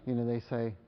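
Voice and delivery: ordinary talk, nothing marked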